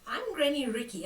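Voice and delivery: ordinary delivery, nothing marked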